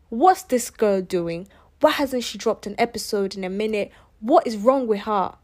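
loud, put-on British accent; mock British accent. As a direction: imitate indignant listeners in a British accent. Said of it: "I'm sorry about the British accent".

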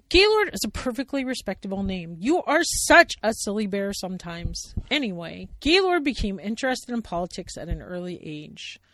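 loud prim bear; Sally Bear voice. Acted. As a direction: defend the name primly. Sally first take.

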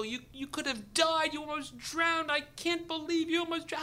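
high, distraught imitation of wife; mock crying. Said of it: "she's crying, she's inconsolable".

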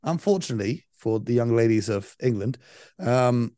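normal talking voice

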